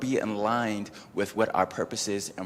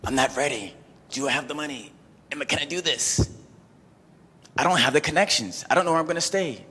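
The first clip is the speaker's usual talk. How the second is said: rising panic, getting higher and louder; anxious panic building from higher to much higher and louder; panicking; 7 semitones higher, 5 dB louder, faster than usual. Retold or imitated. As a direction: spiral into worry, pitch climbing. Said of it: "Emotionally, I'm going".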